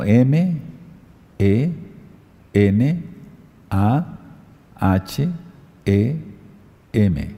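slow dictation, one letter at a time; each letter dictated with a pause; spelling out letters. Shown as a demonstration. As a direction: spell it letter by letter for them to write. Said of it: "yo se los dicto".

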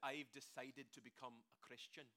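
put-on quoting a skeptic in a Scottish accent; a lightly voiced Scottish accent, rising at the end. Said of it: "I wish I could preach the entire sermon in this accent".

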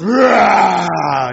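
loud roaring dragon. Retold or imitated. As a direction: roar it out like a dragon. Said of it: "But it goes".

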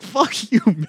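high, loud playful yell; mock outrage. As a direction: shout it in fake fury.

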